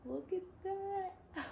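very high, soft, slow squeak; tiny high voice, near tears with wonder; overwhelmed awe. Real, not acted. Overwhelmed by the belly plate.

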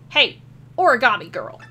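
loud call across. Pretend. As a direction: shout to get her attention. Character calling out.

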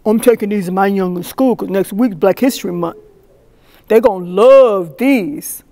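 imitated guy savoring his plan; imitated country guy. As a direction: play a proud guy, stretching the last words. Voices another guy with the coupons.

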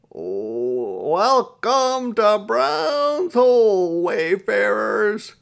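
booming, high, drawn-out Scots welcome; thick Scots brogue. A narrator's reading. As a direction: holler a welcome in broad Scots. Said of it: "He said in a thick Scots brogue".

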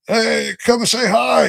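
high, slow coaxing call to the deer; lightly voiced old man inviting deer over; gentle old man calling animals. [name] greets the deer.

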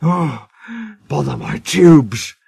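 slow, out-of-breath grumble; winded old engine; panting. A narrator's reading. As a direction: puff it out, short of steam. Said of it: "he panted".

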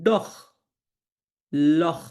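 "ডখ", "লখ" each ending in throaty kh; word by word, close "ডখ" and "লখ" on kh; kh sound for CH. Shown as a demonstration. Teacher drilling CH after O, "ডখ", "লখ" with kh. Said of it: "এটা খ এর মতো উচ্চারণ".

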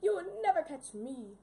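loud, cocky taunt; gleeful taunt. Acted. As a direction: tease the chaser as you run. Taunting pursuer while fleeing.

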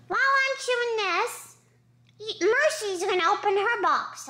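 high, playful pet voice; dog voice. Acted. Dog voice narrating, pitched up.

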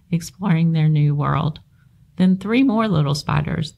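plain reading voice

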